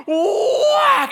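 very high, loud drawn-out whack yell; mock battle cry building into the blow; drawn-out attack yell. Imitating the guy swinging a shoe, high yell. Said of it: "he grabs a shoe and he goes".

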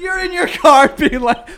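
shouting through laughter; can barely get it out for laughing; laughing hard. Real, not acted.

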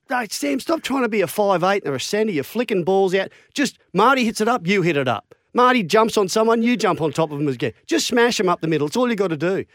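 Wayne Bennett lecturing. Retold or imitated. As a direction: drill the simple job into him. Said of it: "then he said".

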